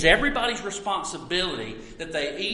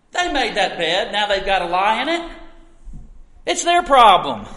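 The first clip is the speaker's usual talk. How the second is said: louder, scornful brush-off; dismissive mock voice; 3 semitones higher, 8 dB louder than usual. Preacher voicing uncaring people, loud and scornful. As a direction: toss it off like it's not your problem.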